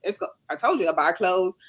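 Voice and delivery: ordinary talk, nothing marked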